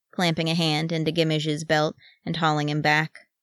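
plain reading voice

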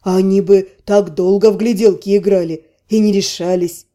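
low male voice. A narrator's reading. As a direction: say it low, amused. Erelev speaks.

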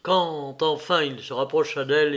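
ordinary delivery, nothing marked

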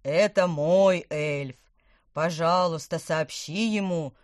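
loud, slow booming Santa. Acted. Santa speaks on phone.

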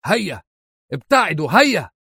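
high-pitched angry cry; angry yelling. A narrator's reading. Rancher shouting his men away, angry high call. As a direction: call out angrily, pitched well up. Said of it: "صارخا فيهم بكل ما ملأ نفسه من غضب".

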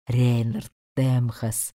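low, slow demon naming him. A narrator's reading. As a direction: drag out the name darkly. Name repeated by demon. (медленно, низким голосом демона)

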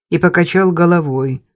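usual speaking voice

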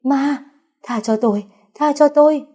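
shrill high cries begging for mercy; terrified scream. A narrator's reading. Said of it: "có tiếng hét của Vũ Huệ".

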